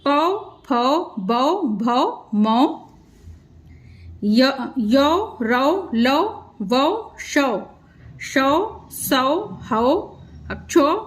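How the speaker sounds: each syllable with au drawn out slowly, one by one; patient teacher reading the chart slowly; slow syllable drill. Shown as a demonstration. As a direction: chant the au sound on every consonant, slowly. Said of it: "एक बार देखिए आप कैसे पढ़ा जाएगा".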